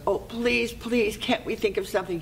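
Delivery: lightly acted high-pitched begging; pleading. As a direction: plead as the desperate queen, a little hammed-up. Queen pleads for another bargain.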